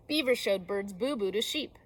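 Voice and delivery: usual speaking voice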